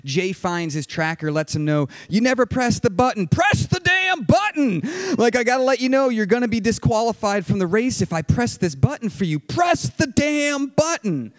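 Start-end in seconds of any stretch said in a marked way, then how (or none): 2.1-11.3 urgent angry yell. Retold or imitated. Jay yelling at Scott to press the SOS button, angry. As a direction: bark the command over the wind.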